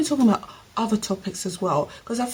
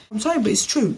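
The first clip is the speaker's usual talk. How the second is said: ordinary delivery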